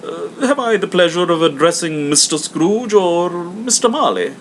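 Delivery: a courteous charity collector; hammed-up polite visitor; portly gentleman. Gentleman asks.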